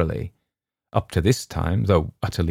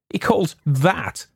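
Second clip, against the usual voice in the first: mocking shout; 8 semitones higher than usual. A narrator's reading. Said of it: "shouted my neighbours".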